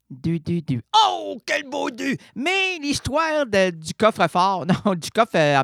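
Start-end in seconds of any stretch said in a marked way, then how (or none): 1.1-3.7 high, slow gushing exclamation; mock gushing. Host gushing in mock delight over a number. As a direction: fawn over it with exaggerated delight.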